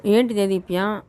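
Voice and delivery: usual speaking voice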